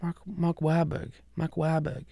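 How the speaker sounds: high, soft attempt at his accent; Mark Wahlberg. Host retrying the name, high soft impression. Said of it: "I can't do it".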